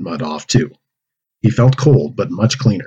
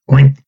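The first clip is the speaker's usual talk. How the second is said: pig oink; 5 semitones higher, 6 dB louder than usual. Acted. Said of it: "More noise from Bertha".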